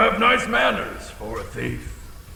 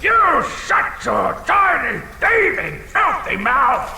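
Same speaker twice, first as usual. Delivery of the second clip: angry outburst; 6 semitones higher than usual. Acted. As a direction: snap at him. Dragon snaps at the thief.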